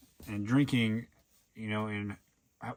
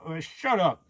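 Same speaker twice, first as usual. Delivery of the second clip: louder, higher brush-off; mock tough-guy snap, pitched up; dismissive retort; 7 semitones higher, 5 dB louder than usual. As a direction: shut him down with a loud scoff. Retorting to whiny coworker.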